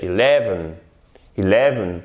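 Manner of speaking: "eleven" stressed on LE, low and falling; correct stress on eleven. Shown as a demonstration. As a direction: hit the middle syllable: eLEven. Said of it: "accent tonique sur la seconde syllabe". (eleven accentué sur la seconde syllabe)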